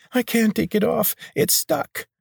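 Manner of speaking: very high, squeaking and breaking to a whisper; panicked squeak. A narrator's reading. Healer squealing in fear that the ring is stuck. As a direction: squeal it in fright.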